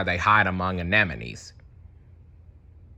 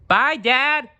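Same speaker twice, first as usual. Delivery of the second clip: put-on high, louder child's goodbye; Nemo shouting bye; 5 dB louder than usual. Nemo shouting bye to Dad as he leaves. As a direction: sing out a happy kid's goodbye. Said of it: "Nemo shouted".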